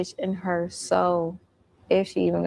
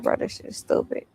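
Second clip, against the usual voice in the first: muttered thought. Retold or imitated.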